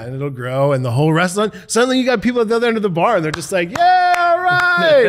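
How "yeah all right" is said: long, high drawn-out whoop; stretched-out high holler of approval; bar crowd cheer. Retold or imitated. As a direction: imitate rowdy patrons joining in. Acted bar patrons cheering along.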